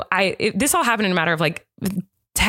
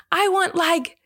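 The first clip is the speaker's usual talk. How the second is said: put-on high, drawn-out child voice; little kid; 7 semitones higher, much slower than usual. Imitating son asking mom for siblings, high slow kid voice. Young son's outrageous wish, acted out.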